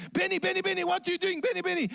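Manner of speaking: a teacher scolding a sleeping pupil; upset teacher calling. Retold or imitated. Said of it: "very upset".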